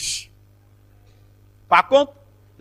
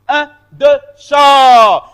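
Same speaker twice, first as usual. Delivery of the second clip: loud, higher shouted count-in; 6 semitones higher, 11 dB louder, much slower than usual. Real, not acted. Teacher cueing the class after a correction, shouted count. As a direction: shout the beat, stretching the last word.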